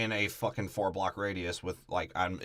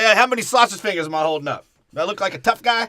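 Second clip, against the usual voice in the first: mock tough guy; 7 semitones higher, 6 dB louder, faster than usual. Voicing Pesci mocking.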